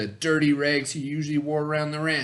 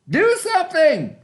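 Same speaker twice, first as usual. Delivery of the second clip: much higher, louder put-on voice; wicked daddy; 7 dB louder than usual. Wicked daddy ordering his sons, high and loud. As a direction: bark the order at your sons. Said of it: "said their wicked daddy".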